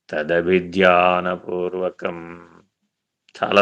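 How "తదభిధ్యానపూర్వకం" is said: each syllable drawn out very slowly; slow, reverent spelling-out of a sacred term; slow enunciation. Shown as a demonstration. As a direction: lay the word out slowly, weighing every syllable. Key word repeated as refrain.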